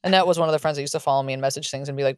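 usual speaking voice

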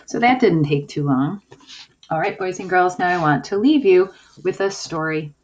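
normal talking voice

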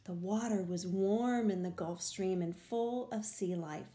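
ordinary delivery

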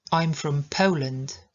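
"I'm from Poland" slowly, "Po" gliding to w; slow it down, POH-land; slow model. Shown as a demonstration. Teacher repeating slowly for students. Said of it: "bardzo ważne dla nas zdanie".

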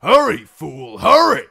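loud, high, climbing to a scream; frantic yell. Acted. Guard screams at Reek to hurry under fire.

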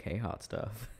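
ordinary talk

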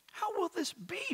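very high-pitched, lightly mimicked questioner; Mary's question. A narrator's reading. Mary asking the angel, high-pitched puzzled question. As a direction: lift it right up high, puzzled. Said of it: "Mary asked the angel".

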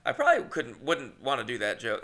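ordinary talk

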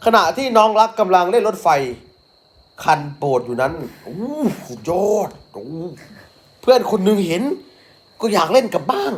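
picture-book voice for a little one; reading to a child. Acted. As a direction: read it brightly for a toddler.

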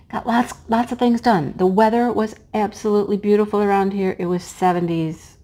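ordinary talk

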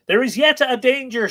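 high, loud narrated call. A narrator's reading. Woman warning Art as he leaves, calling out. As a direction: call the warning out across the water. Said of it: "she called".

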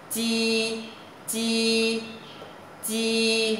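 each "chi" high, level and held, without aspiration; emphasized pronunciation. Shown as a demonstration. Teacher repeats the new sound for students, emphasized pronunciation. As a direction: drill "chi" as separate sustained high notes. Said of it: "dấu sắc mà đọc nhấn mạnh".

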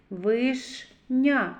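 Вишня with Ш held before the last syllable; stress on Ш. Shown as a demonstration. Teacher isolates the hard consonant, Ш emphasized. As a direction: linger on the Ш in Вишня. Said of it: "ш, твердий приголосний".

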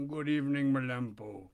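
low, sly voice of a polecat. Acted. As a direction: greet him low and crafty. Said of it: "said in a low voice".

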